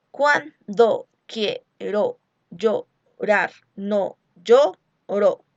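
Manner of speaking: very slow, every syllable pulled apart; drawn-out metrical reading of the verse; scanning syllables. Shown as a demonstration. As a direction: count out the metre syllable by syllable. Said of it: "contemos número de sílabas".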